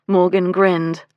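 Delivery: ordinary delivery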